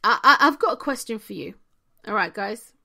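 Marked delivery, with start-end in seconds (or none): none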